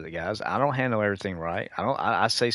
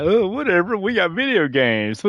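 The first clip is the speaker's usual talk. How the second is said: mocking dismissive viewer; 12 semitones higher, 9 dB louder than usual. Mocking gamers who shrug off warnings, high goofy mock voice. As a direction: whine it goofily, ending in a silly laugh. Mocks how viewers react.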